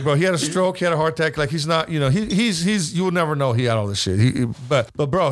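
usual speaking voice

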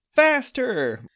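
high, loud cry to hurry; urgent shout. Acted. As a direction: spur them on loudly. Urging friend on the ropes.

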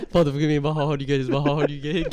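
low, fast gabbled prayer; mock rapid-fire recitation. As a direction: race through it like a joke challenge. Jokingly speed-reciting a mock prayer challenge.

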